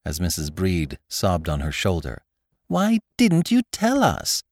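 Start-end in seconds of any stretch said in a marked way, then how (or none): 2.7-4.4 high, slow tender question; wife gently asking the bride, much higher; gentle reproach. A narrator's reading. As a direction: coax the truth out kindly. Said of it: "my wife cried".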